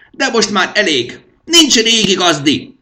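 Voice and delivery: high, loud shrill cry of command; high screeching royal outburst; shrieking king. A narrator's reading.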